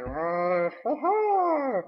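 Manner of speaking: a hint of a forced priest laugh; lightly voiced awkward chuckle; fake-happy priest. Mimicking the creepy priest's fake cheer. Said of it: "acting all, like, happy".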